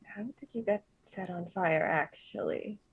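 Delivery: soft conspiratorial stage whisper. Acted. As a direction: lean in and ask under your breath. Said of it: "stage whispers".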